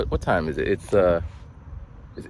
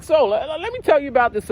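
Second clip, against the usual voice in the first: high-pitched robocall sales pitch; automated caller; 14 semitones higher, 3 dB louder than usual. Retold or imitated. Automated caller pitching a program, high chirpy imitation. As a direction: mimic a perky recorded salesperson. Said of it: "I'm talking to this robot".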